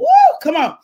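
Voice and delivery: high loud ooh, come on; joyful preacher call; excited ooh. Real, not acted. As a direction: hype the crowd up. Preacher exclamation.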